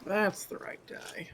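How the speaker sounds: whispered to himself; whispering. Real, not acted. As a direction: whisper it under the breath. Muttered over the dice.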